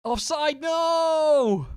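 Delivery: high, loud, drawn-out cry of protest; dismayed shout. Real, not acted. Player seeing his goal ruled offside, dismayed shout. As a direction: yell out in real dismay at the call.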